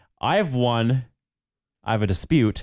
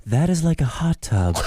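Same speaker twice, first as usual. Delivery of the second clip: witchy, higher croaking voice; creepy witch voice; 6 semitones higher than usual. Acted. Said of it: "Sounding like a creepy witch".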